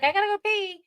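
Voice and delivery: put-on high-pitched small-child voice; high, childlike mock whine; imitated little girl. Voicing little girl needing bathroom.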